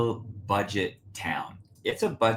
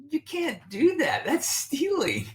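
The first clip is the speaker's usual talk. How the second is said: high, pitched-up scold, slowing on the last words; mock scolding. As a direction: play a shocked scold in a high put-on voice. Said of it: "and I'm like".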